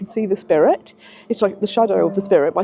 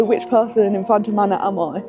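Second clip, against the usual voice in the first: ordinary delivery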